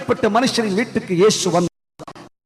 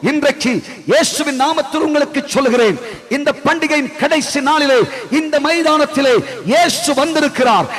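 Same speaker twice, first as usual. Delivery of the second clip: each இயேசு வந்தால் hammered like a hammer blow; preaching at full force; 6 semitones higher than usual. Acted. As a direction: build the crowd to a proclaiming climax. Rising altar call.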